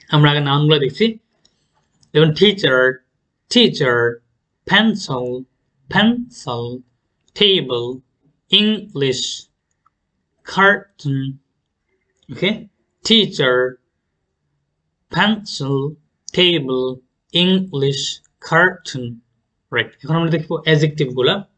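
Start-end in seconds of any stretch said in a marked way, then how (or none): 2.3-11.4 teacher, pencil, table stressed hard on the first syllable. Shown as a demonstration. Teacher models two-syllable nouns, first-syllable stress. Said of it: "first সিলেবলে stress করব". 13.0-19.2 teacher, pencil, table each punched on the first syllable; first-syllable stress. Shown as a demonstration. Teacher repeats the noun list for learners, first-syllable stress. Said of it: "first সিলেবলে stress করব".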